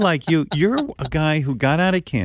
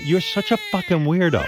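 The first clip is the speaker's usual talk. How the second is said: laughing; 6 semitones higher than usual. Real, not acted. Laughing at Richard's crying clip.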